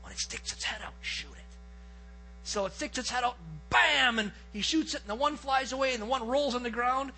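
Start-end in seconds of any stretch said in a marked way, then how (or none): 0.0-1.6 hushed, low hunting whisper. Retold or imitated. Hunter instructing his partner as toms approach, retold whisper. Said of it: "I whisper to Brett". 3.7-4.2 sudden punched bam; gunshot imitation. Storyteller as his partner shoots, imitated gunshot.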